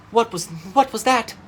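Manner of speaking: high, loud startled gasp; frightened stammering question; gasping fright. A narrator's reading. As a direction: blurt it out in sudden fear.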